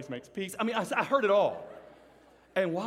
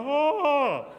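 put-on high, loud startled kid's yelp; a scared kid jumping back; 9 semitones higher, 7 dB louder than usual. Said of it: "This little kid freaked out".

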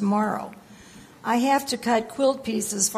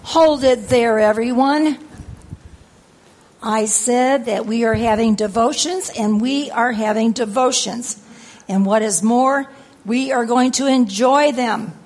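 raised, emphatic scolding; stern command; 5 dB louder than usual. Acted. Grandma ordering the family to have devotions, stern raised voice. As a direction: lay down the law firmly and loudly.

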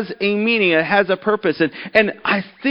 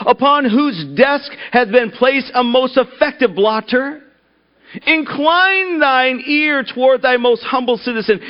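pompous prayer voice; 4 semitones higher than usual. Acted. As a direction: intone it like a self-important preacher. Pompous man continues prayer.

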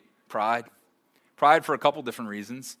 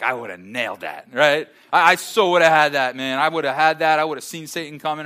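loud, cocky boast, mocking it; mock bravado; 8 dB louder than usual. Pastor imitating churchgoers who think they'd beat Satan, loud mock bravado. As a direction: brag like you'd never fall for it. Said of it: "And you're like".

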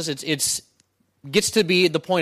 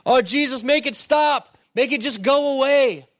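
higher, pleading cry to God; mock desperate prayer; 8 semitones higher than usual. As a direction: beg God to stop it, half-mocking. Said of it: "We're, we're praying".

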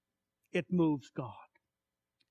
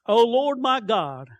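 slow, raised-pitch call to God; psalm cry; 4 semitones higher, 6 dB louder, slower than usual. Acted. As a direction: call out to God, drawing the words out. Declaims a psalm aloud.

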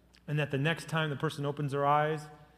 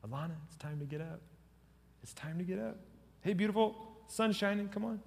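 gentle wake-up; 5 semitones higher, 5 dB quieter than usual. Retold or imitated. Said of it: "kinda rub on the forehead".

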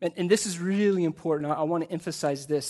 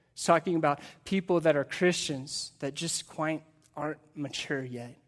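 usual speaking voice

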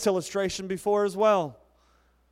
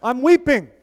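loud mock hashtag; 6 semitones higher, 9 dB louder than usual. Shown as a demonstration. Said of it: "I almost forgot it".

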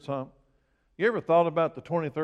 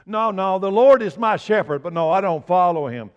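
loud, high, mocking voice of a careless believer; 6 semitones higher, 11 dB louder than usual. Pastor mocking churchgoers who claim but don't follow, loud and high. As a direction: ham up the excuse of someone who won't follow.

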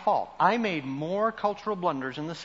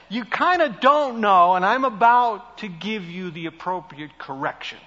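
ordinary delivery

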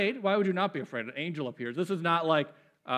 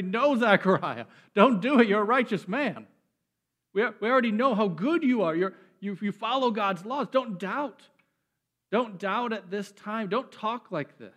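raised, loud plea, easing toward the end; crying out in protest; 5 semitones higher, 5 dB louder than usual. Acted. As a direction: cry out as if to stop a righteous man, gradually softening. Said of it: "we should be crying out".

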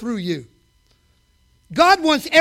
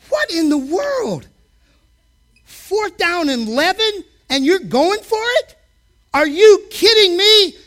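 rising to a slow, loud mock yell; fan yelling at the TV; 5 semitones higher, 4 dB louder than usual. Fan yelling at his team on TV, mock disbelief. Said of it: "I'm talking to the T V like I was there".

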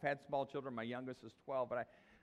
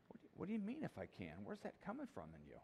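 soft probing challenge; 4 semitones lower, 10 dB quieter than usual. Retold or imitated. Jesus directly questioning our doubt.

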